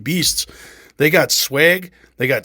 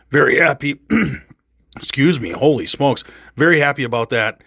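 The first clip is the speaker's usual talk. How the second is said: ordinary talk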